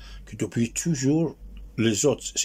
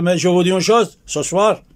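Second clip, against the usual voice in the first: loud, raised proclamation to the troops; British general's speech; 7 semitones higher, 7 dB louder than usual. Retold or imitated. British general addressing his soldiers in Haifa, loud retold proclamation. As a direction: announce it forcefully, pitched high, as an acted general. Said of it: "le général, il commence à parler".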